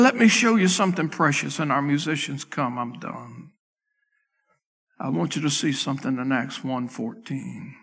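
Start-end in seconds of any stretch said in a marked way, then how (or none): none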